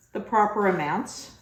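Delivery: normal talking voice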